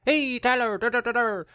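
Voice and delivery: high, loud, lilting mock-Irish greeting; Irish leprechaun. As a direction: pipe up like a little Irish leprechaun. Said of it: "little leprechaun could walk in and be like".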